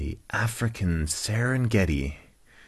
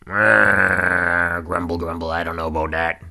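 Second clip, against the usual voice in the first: put-on grumbling old rhino; Rex; 5 dB louder than usual. Grumpy old rhino doubting the happy stranger, grumbling voice. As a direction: open on a loud grumble, then settle into usual pitch. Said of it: "grumpy old cantankerous rhinoceros".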